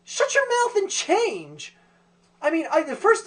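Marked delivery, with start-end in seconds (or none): 0.0-1.7 high, loud, drawn-out rebuke; mock yell. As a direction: yell it at the character, stretched out. Mock yelling at film character.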